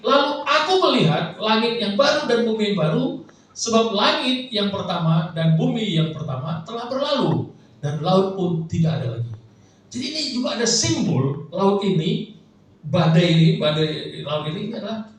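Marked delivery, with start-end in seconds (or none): 0.1-9.3 raised, measured reading of the verse; scripture reading. Acted. Preacher reads Revelation to the congregation, proclaiming.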